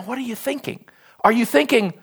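ordinary delivery

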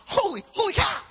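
high, loud astonished cry; delighted disbelief with a laugh; amazed exclamation. Retold or imitated. Amazed at newspaper letter.

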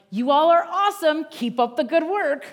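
loud, bright mock cheer; mock cheerful praise. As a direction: belt it out like an over-the-top pep letter. Mock cheerful epistle quote.

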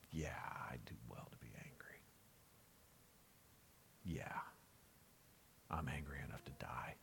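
exhausted, hushed whisper of a broken man; despairing whisper. Acted. Preacher voicing Jonah to God, despairing whisper. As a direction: breathe it out like someone with nothing left. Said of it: "Jonah in his despair, in his pain".

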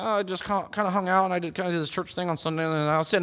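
sheepish mumble. Acted. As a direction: mumble it away like you're embarrassed to share. Acting out a sheepish Monday reply.

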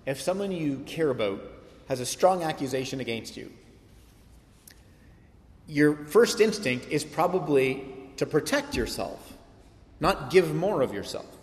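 ordinary delivery

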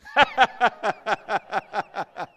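loud, high, deliberate ha-ha laugh; forced belly laugh, kicked off loudly; practice laughing. Shown as a demonstration. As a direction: laugh on purpose in a long, even run. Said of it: "So I want you to laugh".

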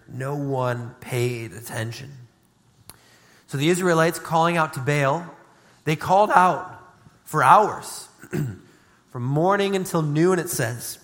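ordinary talk

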